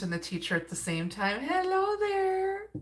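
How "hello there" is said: much higher, slow, cooing hello; sing-song greeting. Real, not acted. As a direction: coo a playful hello to a friend in the chat. Greeting a viewer in chat.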